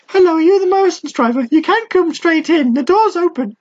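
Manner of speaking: very high, put-on posh phone voice; mock British answering the door to a driver; mock British accent. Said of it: "what was that accent you were trying to do".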